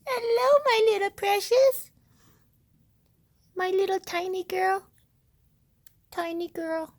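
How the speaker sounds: very high, cooing pet voice. Real, not acted. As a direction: coo at a tiny puppy, high and doting. Baby talk to the puppy.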